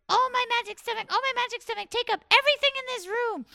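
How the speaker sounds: high, loud chanting call; high rooster incantation. Acted. Rooster summoning the bees from his stomach, high call. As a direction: call out the spell in a squeaky bird voice.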